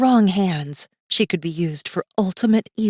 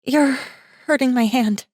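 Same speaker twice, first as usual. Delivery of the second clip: put-on higher, louder young woman's complaint; girl in pain; 7 semitones higher, 4 dB louder than usual. Girl telling Masaaki he hurts her hand, higher and pained. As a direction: tell him he's hurting you.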